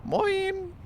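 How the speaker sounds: bright squeaky greeting; sweet, very high "Moin"; nice high moin. Shown as a demonstration. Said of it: "Say nice".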